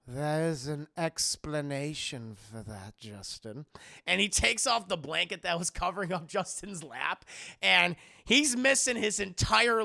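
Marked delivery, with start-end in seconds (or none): 0.1-3.6 low, slow, ominous voice; quiet, measured villain reveal; sinister host. Retold or imitated. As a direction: let it sink softly to the name. Howard about to reveal missing leg.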